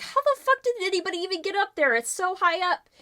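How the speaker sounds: high, fast and loud in disbelief; disbelieving outburst. Acted. Professor Wood to the group, high, fast, loud disbelief. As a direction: blurt it out, stunned at how high it is. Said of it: "Professor Wood's just like".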